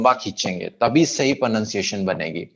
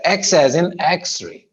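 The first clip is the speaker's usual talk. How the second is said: slower, higher X-ray with X stretched; long first syllable; 4 semitones higher, much slower than usual. Shown as a demonstration. Teacher repeats X-ray after explaining, first syllable lengthened. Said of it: "ये पहला syllable है".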